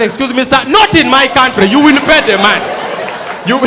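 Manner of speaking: loud, indignant official voice; shouted insisting. Acted. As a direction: demand payment at full volume.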